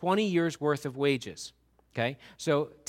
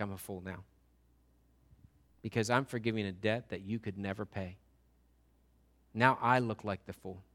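king forgives the servant, much softer; gentle king; 10 semitones lower, 10 dB quieter than usual. Pretend. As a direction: let the king release him tenderly.

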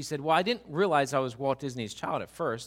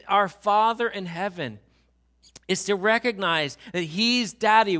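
emphatic; 5 semitones higher, 6 dB louder than usual. Shown as a demonstration. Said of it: "just to kind of walk through this".

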